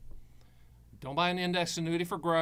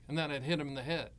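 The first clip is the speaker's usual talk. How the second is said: normal talking voice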